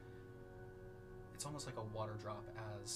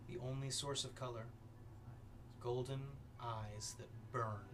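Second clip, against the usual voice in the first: ominous narration; 3 dB quieter than usual. A narrator's reading. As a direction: paint the vision with dread. GM dark scene narration.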